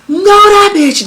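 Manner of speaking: high, indignant retort; heated. Retold or imitated. Realizing the thief took the diapers, high heated retort. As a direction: spit it out, offended. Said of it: "I get, like, heated".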